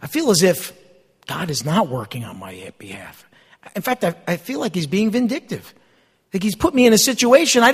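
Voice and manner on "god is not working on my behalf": hushed despairing whisper. Retold or imitated. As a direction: breathe it out like a secret complaint. Voicing despair to God.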